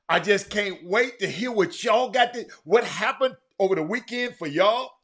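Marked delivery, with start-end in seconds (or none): none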